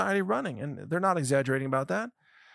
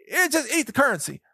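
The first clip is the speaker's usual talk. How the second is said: mocking handout; 8 semitones higher than usual. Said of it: "but it's like".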